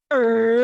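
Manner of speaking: sudden loud puzzled grunt; a short loud confused grunt; startled er. Retold or imitated. Host reacting to her friend's remark with a loud confused grunt. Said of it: "And I was like".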